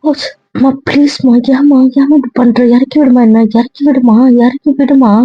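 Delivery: high-pitched, repeated begging; flustered pleading. Acted. Begging to be put down.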